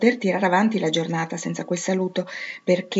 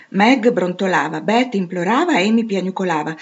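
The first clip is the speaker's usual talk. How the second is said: ordinary delivery, nothing marked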